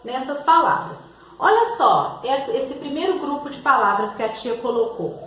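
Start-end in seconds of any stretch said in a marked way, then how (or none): none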